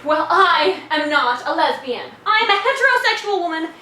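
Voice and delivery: emphatic, affronted declaration; indignant denial. Acted.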